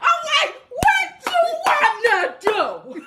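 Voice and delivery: high, shouting dare; mock tough challenge. Woman replaying daring her boyfriend, high and shouting. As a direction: yell the dare like you're ready to fight. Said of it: "I'm a G".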